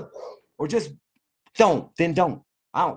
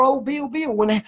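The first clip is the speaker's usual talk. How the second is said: high mock voice whining his name; mocking whiny critic; 10 semitones higher than usual. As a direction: sneer at the critics by aping them high.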